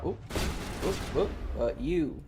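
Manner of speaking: ordinary delivery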